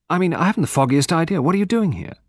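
high, quick, puzzled challenge; baffled demand. A narrator's reading. Hugo challenging Poirot's presence, baffled and high. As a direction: question the stranger in baffled indignation.

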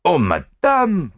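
slow, drawn-out courteous protest; Poirot politely protesting to Mrs Oppelson. A narrator's reading. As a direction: gently wave off the trouble. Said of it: "protested Poirot".

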